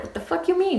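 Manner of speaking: high-pitched baffled "what?"; retold disbelief. Retelling her shocked reply to boyfriend.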